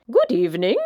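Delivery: smooth, silky greeting; a well-groomed monster purring hello; refined monster introducing itself, silky and smooth. A narrator's reading. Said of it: "he said in a high, silky voice".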